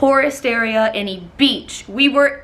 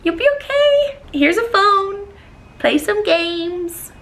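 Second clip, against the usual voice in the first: much higher, sing-song reassurance; patronising cheer; 8 semitones higher, slower than usual. Retold or imitated. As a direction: talk to him like a child.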